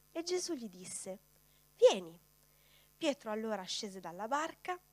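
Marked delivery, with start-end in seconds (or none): 1.8-2.2 loud single-word command; firm command. A narrator's reading. Jesus telling Peter to come, loud firm call. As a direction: call him over with authority.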